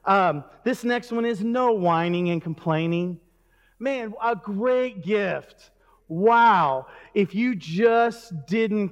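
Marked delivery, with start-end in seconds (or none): none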